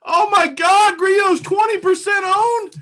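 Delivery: high, loud shocked outburst. Real, not acted. Host learns his fade is popular, shocked. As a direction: yell it in disbelief.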